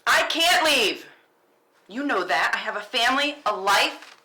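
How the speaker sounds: loud, high cry of I can't leave; anguished shout. Acted. Ryan yelling at Penny that she has a family. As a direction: shout it out, cornered and desperate.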